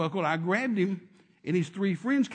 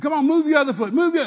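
much higher and loud; shouting command; 8 semitones higher, 10 dB louder than usual. Retold or imitated. Preacher shouting at the man to move his foot. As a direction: shout him into moving. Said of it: "then I said".

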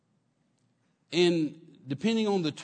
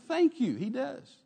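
bright thank you; 9 semitones higher, slower than usual. Retold or imitated. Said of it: "Jackie said".

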